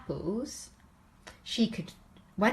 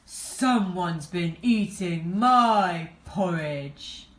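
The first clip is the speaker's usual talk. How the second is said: slow, louder big-bear voice; Daddy Bear finds his bowl, shouting; great big shout; 6 dB louder, slower than usual. A narrator's reading. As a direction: boom it out, stretching each word. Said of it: "he shouted".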